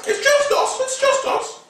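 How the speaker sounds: put-on very high squeaky kid voice; high-pitched play voice; squeaky voiced Finn and Rey. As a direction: squeak it out like a tiny hero.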